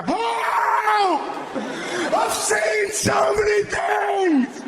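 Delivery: a laptop screaming to be set free, hammed-up; panicked laptop. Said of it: "the laptop is just gonna go".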